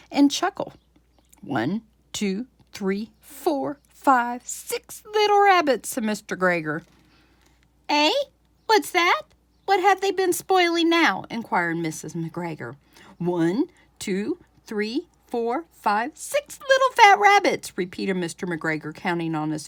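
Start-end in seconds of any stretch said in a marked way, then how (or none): 1.4-5.9 put-on old farmer's count climbing higher and louder; gleeful farmer counting. 7.9-11.2 put-on high, loud, suspicious old wife; nagging farmer's wife. 13.2-17.8 put-on old farmer's count climbing higher and louder; gleeful farmer counting.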